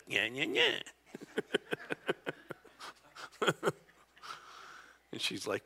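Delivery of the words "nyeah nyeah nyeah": very high whiny nyah-nyah; mock nagging tone. Husband showing his wife how it sounded, mock nagging. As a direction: mimic an irritating tone, exaggerated. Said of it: "it sounded like".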